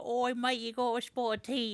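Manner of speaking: high, mock Cockney British accent. Host mocking a British accent for his cohost, Cockney. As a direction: ham up a cheeky British accent. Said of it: "You can't be like".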